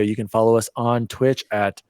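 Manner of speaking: ordinary talk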